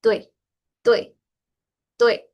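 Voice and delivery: "tui" with a hint of E slipped in before the I, like tuei; teacher modelling "tui" for students; correct pronunciation. Shown as a demonstration. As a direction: say "tui" gliding through a small E. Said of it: "si ustedes me escuchan decirla correctamente".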